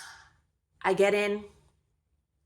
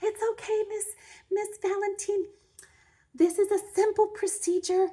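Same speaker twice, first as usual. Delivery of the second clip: nurse voice; 13 semitones higher, 7 dB louder than usual. Retold or imitated. Nurses soothing the crying patient, in a high sugary coo. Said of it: "So the nurses are like".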